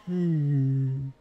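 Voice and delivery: usual speaking voice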